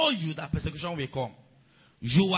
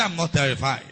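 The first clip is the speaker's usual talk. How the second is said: congregation repeat; 4 semitones lower, 6 dB louder than usual. Shown as a demonstration.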